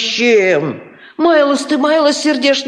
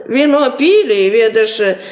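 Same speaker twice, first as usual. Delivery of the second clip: ordinary delivery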